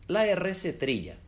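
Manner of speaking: ordinary delivery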